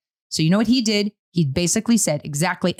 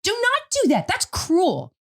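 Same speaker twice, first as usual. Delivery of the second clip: higher, emphatic objection; 11 semitones higher than usual. Retold or imitated. Mom objecting to letting kids cry, emphatic and higher. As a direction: rise up in firm protest. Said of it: "I would say".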